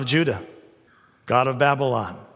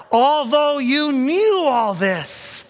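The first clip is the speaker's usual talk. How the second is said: drawn out, much higher on knew; dramatic emphasis; 9 semitones higher, 3 dB louder, much slower than usual. Acted. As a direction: stretch it out, soaring. Dramatic scripture climax.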